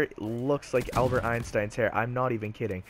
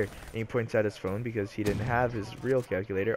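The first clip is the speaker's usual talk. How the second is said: ordinary talk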